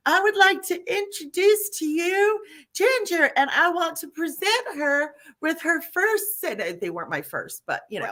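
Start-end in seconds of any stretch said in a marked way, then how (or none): 0.1-6.4 high-pitched mock ringmaster introduction; sustained high proclaiming voice, imitating a hollered announcement; imitated screaming announcement. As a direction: present someone grandly to a whole crowd. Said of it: "and she's screaming".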